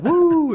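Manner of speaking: loud high woo with a laugh; laughing whoop; whooping cheer. Retold or imitated. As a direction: whoop like a lad on the piss. Cheer after greeting.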